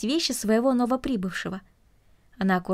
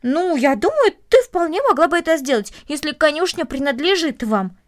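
put-on higher, louder boyish insistence; eager encouragement; 5 semitones higher, 6 dB louder than usual. Boy insisting the girl can visit the stable, eager. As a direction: argue brightly that it can surely be done.

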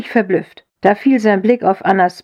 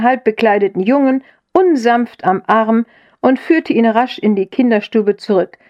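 usual speaking voice

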